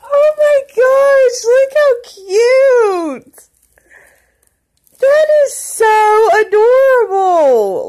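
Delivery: high, loud, drawn-out tearful squeal; happy tearful gushing. Real, not acted. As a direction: wail it out, overwhelmed by how cute it is. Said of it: "I'm gonna cry".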